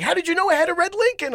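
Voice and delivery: amazed, almost shouting; a shocked father can't believe it; astonished. Retold or imitated. Stunned father asks again.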